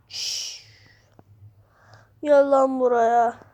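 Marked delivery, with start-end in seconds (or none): 0.1-0.6 hush. Real, not acted.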